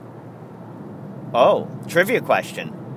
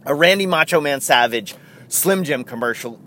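usual speaking voice

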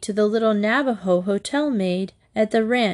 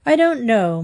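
plain reading voice

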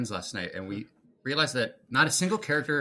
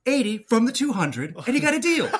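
high, loud freak-out impression; Nicolas Cage; 13 semitones higher, 8 dB louder than usual. Fan quoting the line to Doctor Who, freaking out loudly. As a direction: yell it frantically, hands-in-the-air panic. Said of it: "his hands in the air and freaking out".

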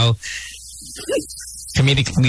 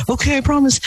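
promising sweetly; 12 semitones higher than usual. Retold or imitated. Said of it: "I said".

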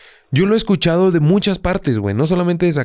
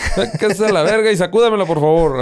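put-on higher, rough northern Mexican accent; norteño tough talk, imitated; mock norteño accent; 4 semitones higher than usual. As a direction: ham up a drunk norteño. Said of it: "empiezo a hablar norteño".

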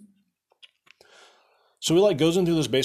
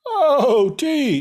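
high, loud comic holler; hammed-up yelp of a man going under; exaggerated cry of alarm; mock drowning cry; 13 semitones higher, 7 dB louder than usual. Drowning hero cries out.

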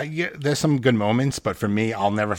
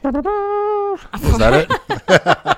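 very high, slow squeaky seahorse; 6 dB louder, much slower than usual. Acted. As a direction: stretch it out like a tiny seahorse. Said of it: "That was really good. I'm impressed".

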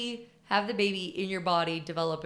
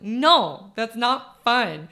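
loud, slow mock scold; stern scolding; 4 semitones higher, 9 dB louder, much slower than usual. Sister shutting down the aunt, loud and slow. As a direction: put your foot down. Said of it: "She's like".

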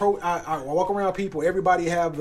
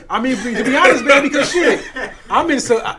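loud laughing talk; laughing through the words; 6 semitones higher, 8 dB louder than usual. Real, not acted. Laughing through his point.

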